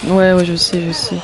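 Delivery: ordinary talk, nothing marked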